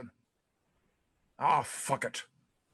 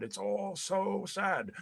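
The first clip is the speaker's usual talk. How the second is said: much softer, slow and mournful; imitating wife sadly; 2 semitones higher, 8 dB quieter, much slower than usual. Husband mimics his wife's sad words, soft and slow. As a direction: let it sink, soft and slow. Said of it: "what is so sad".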